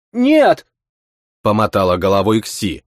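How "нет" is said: put-on high, sharp girl cry of no. A narrator's reading. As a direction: shout it like a frightened girl. Girl protests.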